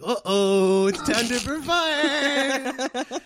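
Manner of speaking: high, slow drawn-out "time to perform"; mock stage-fright singsong; nervous dread. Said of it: "you get a little, like".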